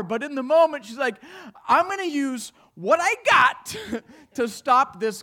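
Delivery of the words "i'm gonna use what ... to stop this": loud, high, slow rallying cry; acted fierce resolve. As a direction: belt it out as her battle cry. Teacher's resolve acted out in story.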